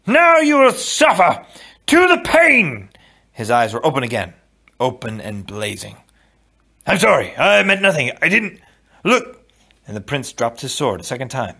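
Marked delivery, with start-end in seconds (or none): 0.1-2.9 high, loud, drawn-out battle cry. A narrator's reading. Wesley rises against the prince, crying out loudly. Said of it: "when Wesley cried out". 6.9-9.5 high, raised, pleading backdown; frightened apology. A narrator's reading. Prince backs down before Wesley, high and frightened.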